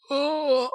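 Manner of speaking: high-pitched, loud "oh" of fright; mock scream. Imitating contestant surprised by Duncan with a knife, mock scream. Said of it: "do, like, a huge scream".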